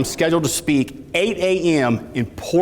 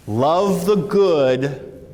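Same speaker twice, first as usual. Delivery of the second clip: stretched out word by word; drawn-out emphatic phrasing; very slow emphasis; much slower than usual. Shown as a demonstration. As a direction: say it very slowly so it sinks in. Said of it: "write this down".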